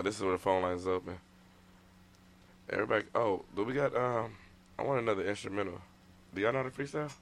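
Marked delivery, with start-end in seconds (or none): none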